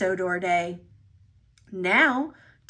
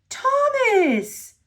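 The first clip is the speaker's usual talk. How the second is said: whistled happily; 13 semitones higher than usual. Acted. Rescuer engine greets his lost friend. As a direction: sing out the name happily. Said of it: "whistled Stanley".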